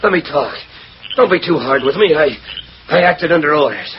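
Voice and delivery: high, frantic begging; desperate pleading. Acted. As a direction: plead for mercy, scared. Beasley begs the men.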